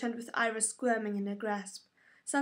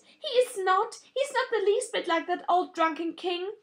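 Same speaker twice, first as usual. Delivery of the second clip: higher, louder tearful outburst; screaming at sister; 8 semitones higher, 5 dB louder than usual. A narrator's reading. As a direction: scream it in grief. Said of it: "she screamed at her sister".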